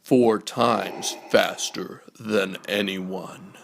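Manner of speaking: usual speaking voice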